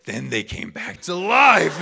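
put-on loud, high, drawn-out triumphant child storyteller; Margot. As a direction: belt out the happy ending like an excited little girl. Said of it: "she's like".

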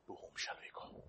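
hushed, voiceless plea; whispered devotion. Acted. Peter answering Jesus, a whispered plea. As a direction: breathe the question out in awe.

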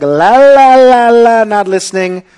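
put-on high, loud childish la la; mock childish not-listening chant; sulky la la la. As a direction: cover your ears and sing it out like a sulking kid. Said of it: "he's like".

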